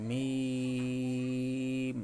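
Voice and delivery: মিম held long and drawn out very slowly; Arabic letter demo. Shown as a demonstration. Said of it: "উচ্চারণ হবে দীর্ঘ সময়". (মিম দীর্ঘ করে টেনে উচ্চারণ)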